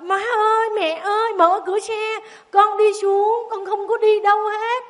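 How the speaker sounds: put-on high, loud pleading child's voice; child begging. Frightened Tí begging the woman to open the car door, high and pleading. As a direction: beg frantically like a scared little boy.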